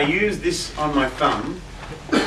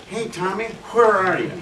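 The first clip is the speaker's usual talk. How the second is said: put-on Tennessee accent; 7 semitones higher than usual. Guitarist imitating his mentor on the phone, strong Tennessee accent. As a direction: greet like an old Tennessee friend, higher voice. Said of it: "he had that real strong Tennessee accent".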